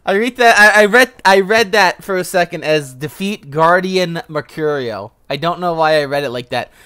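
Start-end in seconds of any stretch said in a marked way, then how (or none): 0.1-5.1 louder, higher amused rambling; laughing at his own misreading; excited, amused. Real, not acted. Gamer laughing at a misread quest line, excited.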